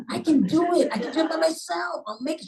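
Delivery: very high, eager protest; competitive insistence. Retold or imitated. Patient telling nurse she can manage alone, competitive and insistent. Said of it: "Remember competitive me?".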